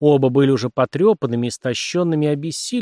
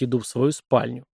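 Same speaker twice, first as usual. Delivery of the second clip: usual speaking voice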